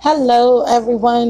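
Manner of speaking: usual speaking voice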